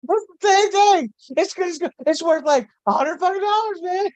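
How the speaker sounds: high-pitched hustler pushing a fake; mock seller voice. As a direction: squeak it up like a shady vendor. Mocking the knockoff seller.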